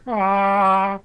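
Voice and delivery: high, loud, held "aah"; heroic "aah" cry. Acted. Player holds up the sun sword, high held "aah". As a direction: sing out a sustained "aah".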